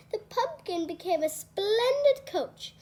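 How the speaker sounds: ordinary delivery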